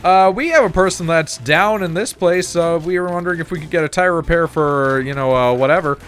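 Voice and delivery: high, bright customer-service phone voice; polite phone operator. Retold or imitated. Calling about a tire repair, imitating his own high polite phone voice. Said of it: "And then I'd be like".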